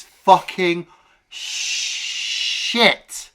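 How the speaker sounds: FUCKING slow, SHIT after a long hissed sh; emphatic verdict. Real, not acted. Giving co-host the verdict on the movie, emphatic. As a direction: draw out the start of SHIT, then hit it.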